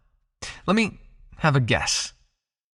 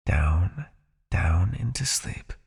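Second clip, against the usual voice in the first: slow, breathy whisper drawing them down; sleepy whisper; 6 semitones lower, much slower than usual. Acted. Hypnotist whispering her subject down into sleep, slow and hushed.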